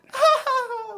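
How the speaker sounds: high-pitched wailing laugh-cry; mariachi scream-cry. Shown as a demonstration. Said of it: "the scream cry thing where you go".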